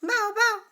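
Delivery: high, bright bow bow like a dog; dog bark. Shown as a demonstration. Teacher demonstrates the dog's sound, high bow bow. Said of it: "Can you name the sounds of these animals?".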